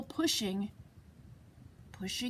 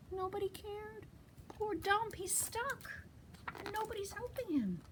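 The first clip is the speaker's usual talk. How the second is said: hushed, higher, pitying voice; soft pity; 5 semitones higher, 13 dB quieter than usual. Acted. Reader to child about poor stuck truck, soft pity. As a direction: feel sorry for him, softly and high.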